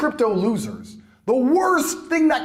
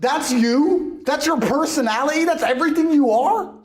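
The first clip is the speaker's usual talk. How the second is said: scornful disbelief; 9 semitones higher, 3 dB louder than usual. Real, not acted. Mocking disbelief.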